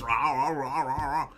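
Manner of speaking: mock chomping growl; munching noises. Streamer mimicking Kidman chomping bugs, growly chew.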